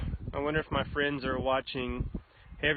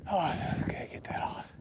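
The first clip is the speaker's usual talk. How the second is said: breathy relieved whisper after the climb; whispered relief, worn out; 6 dB quieter than usual. Real, not acted. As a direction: sigh the words out under your breath as the weight comes off. Pack off after hard climb.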